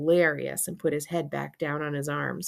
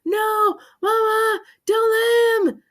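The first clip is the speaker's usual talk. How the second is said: high, loud, muffled protest; frantic high-pitched mumbling; mumbled wail; 7 dB louder, slower than usual. A narrator's reading. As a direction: howl it through stuck lips. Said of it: "and mumbling".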